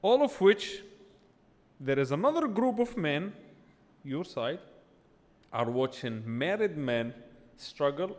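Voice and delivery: normal talking voice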